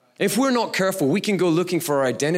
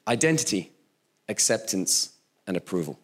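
each point set apart and fading softer; three points spelled out; 7 semitones lower, 4 dB quieter than usual. Shown as a demonstration. Measured list, dropping softer. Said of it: "Three points".

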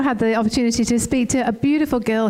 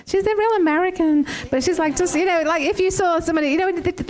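put-on American accent; 6 semitones higher than usual. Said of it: "I thought the accent was great".

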